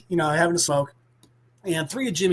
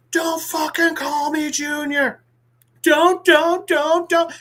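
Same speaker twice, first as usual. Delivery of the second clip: higher, louder hissy fit; mock tantrum shouting at a sibling; freak out; 9 semitones higher, 3 dB louder, slower than usual. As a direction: throw a tantrum, slowly then yelling. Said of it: "the kid freaks the fuck out".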